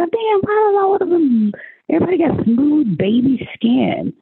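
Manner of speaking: high, incredulous mock outrage; shocked inner thought. Woman at the pool wondering why everyone is smooth, high shocked disbelief.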